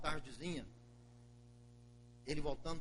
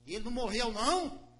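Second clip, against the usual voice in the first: higher and louder, in disbelief; startled older brother; 8 semitones higher, 7 dB louder than usual. Acted. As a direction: gasp it out, he's alive?. Broer schrikt van nieuws.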